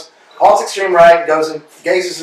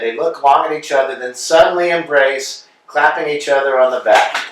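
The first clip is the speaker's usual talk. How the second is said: usual speaking voice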